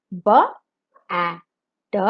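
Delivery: letter by letter, spaced out; sounding out words. Shown as a demonstration. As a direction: sound out B, A, D one by one. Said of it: "sound out the letters and say the word".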